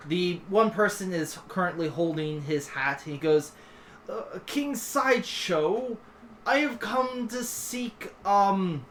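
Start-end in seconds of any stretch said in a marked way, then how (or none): 4.1-8.9 slow, higher, hesitant petitioner; forlorn petitioner. Acted. Forlorn man seeks the king's help, slow hesitant plea. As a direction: plead haltingly, with a touch of the forlorn. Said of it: "looks a little forlorn... He goes".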